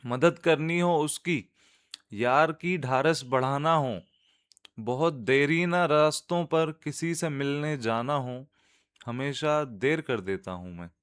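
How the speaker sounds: measured, lilting Urdu verse reading; nazm recitation. Acted. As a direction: recite line by line, letting the refrain settle. (नज़्म सुनाने का अंदाज़)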